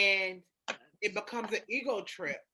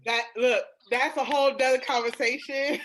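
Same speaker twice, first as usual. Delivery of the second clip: louder, laughing through the words; 5 semitones higher, 8 dB louder than usual. Real, not acted. Laughs while dodging topic.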